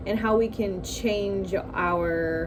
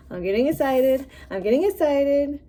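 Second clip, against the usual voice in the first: higher, laughing, rising excitement; getting excited; 6 semitones higher, 3 dB louder than usual. Real, not acted. Tarot reader tells viewers she is thrilled, laughing excitedly. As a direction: let the thrill bubble up through a laugh.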